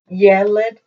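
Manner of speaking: Yeled hit on the opening "Ye"; accent the beginning of Yeled, short second syllable; stress on first syllable. Shown as a demonstration. Teacher explaining the eh sound, Yeled stressed at the start. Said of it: "have the accent at the beginning".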